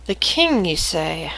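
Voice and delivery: quiet, intrigued murmur that trails down; mumbled. Acted. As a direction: mumble it softly to yourself. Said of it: "the girl kind of mumbles".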